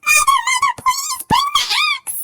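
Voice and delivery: very high, loud frantic yell; Jack yelling. A narrator's reading. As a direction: scream for mother in a panic.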